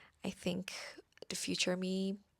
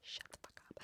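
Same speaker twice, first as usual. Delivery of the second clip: barely audible whispered curse; whispered retort; 19 dB quieter, faster than usual. Acted. Podcaster imagining her reply to optimistic advice, whispered retort. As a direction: whisper it, far under the normal voice.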